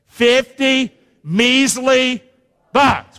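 slow, each word of Fifty measly drawn out; slow call and response. Shown as a demonstration. Preacher leads the chant, drawn out. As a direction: lead the crowd slowly through Fifty measly bucks. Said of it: "Say it with me".

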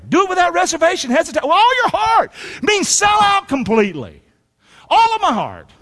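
fired-up preacher climbing to a shout; full-force preaching. Acted. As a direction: preach it out with everything. Preaching builds to peak.

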